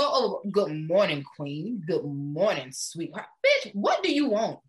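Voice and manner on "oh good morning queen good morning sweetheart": low, slow, smooth mock suitor voice. Mocking a man who texts good morning queen to a woman. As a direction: croon it like a man buttering her up. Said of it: "It's when you go".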